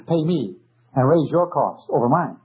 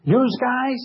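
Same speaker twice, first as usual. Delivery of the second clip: imitating a street-talking judge, pitched up; mock the judge's tough-guy address, higher; tough Detroit judge; 9 semitones higher than usual. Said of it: "that's the way our judges talk".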